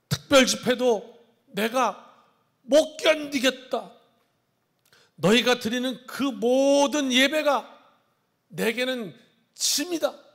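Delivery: loud, slow, heavy voice of God; slow, loud divine rebuke; God's weary complaint. Acted. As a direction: proclaim it as an exasperated God. Voices God refusing worship.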